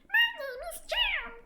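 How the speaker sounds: high, slow squeaky sprite voice; a proud little fairy naming itself; tiny creature. Acted.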